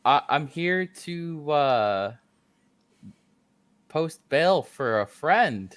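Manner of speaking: slow hesitant. Acted. Nervously speaking to the guard.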